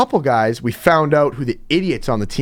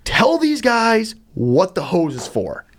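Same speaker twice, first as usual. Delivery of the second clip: insistent; 8 semitones higher, slower than usual. Retold or imitated. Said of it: "I go".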